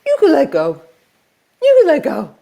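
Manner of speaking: high coaxing pet voice; coaxing a dog. Retold or imitated. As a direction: sweet-talk a dog into obeying. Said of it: "I try a different tactic".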